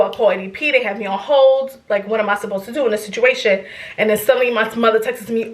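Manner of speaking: ordinary delivery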